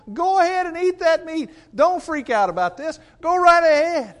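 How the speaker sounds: preacher's high, loud push to the congregation; emphatic urging. Real, not acted. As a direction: push them to go ahead and eat. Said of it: "preaching climax".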